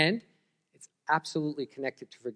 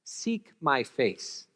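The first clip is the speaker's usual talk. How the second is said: each word laid down slowly; solemn quote from scripture; much slower than usual. Acted. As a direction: let the words Seek my face hang, unhurried. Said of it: "the Bible actually says".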